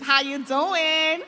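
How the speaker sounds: slow, loud American how you doing; mock American accent. Speaker teases the audience's American accents in a loud, slow American voice. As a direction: drawl it out like an American. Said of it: "now everyone has American accents".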